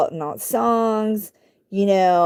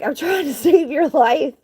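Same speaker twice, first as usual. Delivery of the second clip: higher, laughing through the words; laughing plea; 6 semitones higher than usual. Retold or imitated. Woman rescuing a biting kitten, laughing plea. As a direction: laugh in exasperation as you plead.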